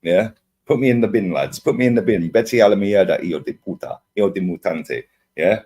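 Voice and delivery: normal talking voice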